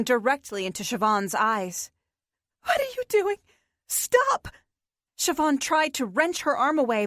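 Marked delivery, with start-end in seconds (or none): 2.6-4.5 high, frightened cry to stop; a girl begging her captor to stop, high and panicked; panicked protest. Acted. Captive girl protests the chant.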